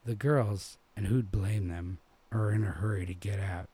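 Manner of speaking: usual speaking voice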